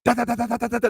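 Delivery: rapid, high imitation of running tap shoes; tap-shoe clatter. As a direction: rattle out quick footsteps with the tongue. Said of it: "They be sound like tap shoes".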